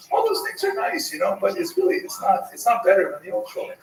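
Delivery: high, airy put-on mother voice; dismissive mother. Mother answering her daughter, high and dismissive. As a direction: voice the mother brushing it off.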